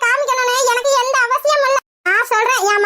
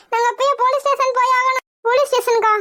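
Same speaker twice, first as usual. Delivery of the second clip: normal talking voice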